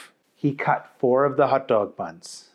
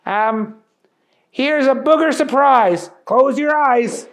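higher goofy voice fumbling the names; Booger voice, bumbling; 10 semitones higher than usual. Acted. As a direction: stammer like a starstruck silly cook. Booger greets famous chefs.

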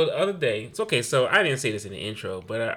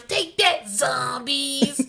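put-on kid battle cry; 13 semitones higher, 3 dB louder, much slower than usual. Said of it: "she goes".

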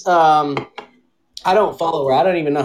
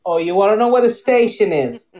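mock New York, rising higher, repeating the question; New York accent; 4 semitones higher than usual. Tourist asks for the station, mock New Yorker repeats it. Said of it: "a New York accent".

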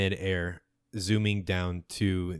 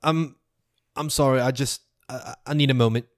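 higher, halting apology; Runzi overwhelmed; 5 semitones higher than usual. Acted. Runzi asking Hirak for a moment, higher and halting. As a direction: stammer out a need for space. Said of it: "He says".

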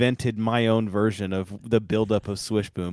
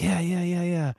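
put-on high, eager boys pleading; eager kids; 9 semitones higher than usual. Boys in the car answering their uncle, eager. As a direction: imitate excited kids begging.

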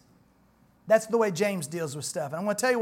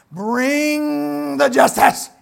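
slow, higher, louder bring-it-on; mock vengeful demand; 5 semitones higher, 8 dB louder, much slower than usual. Preacher mocking our eagerness for punishment, hammed-up. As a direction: ham up the thirst for justice.

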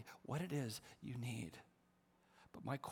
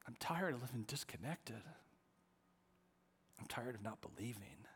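quiet plea; 3 semitones lower, 6 dB quieter than usual. Retold or imitated. Continuing his quiet prayer.

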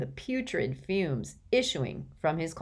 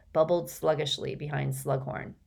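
plain reading voice

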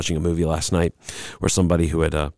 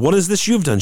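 higher, incredulous question; lightly voiced God; 9 semitones higher, faster than usual. God questioning the woman, higher and incredulous. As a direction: ask it in rising disbelief. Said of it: "the Lord God said to the woman".

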